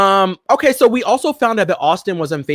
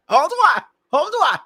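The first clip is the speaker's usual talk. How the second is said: laughing; 13 semitones higher than usual. Real, not acted. Breaks into laughter.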